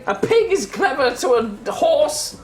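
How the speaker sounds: haughty elf. Acted. Elf retort, high and laughing.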